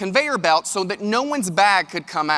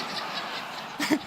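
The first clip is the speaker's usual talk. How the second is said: breathy laugh; 6 semitones higher, 13 dB quieter than usual. Real, not acted. Laugh after airport story.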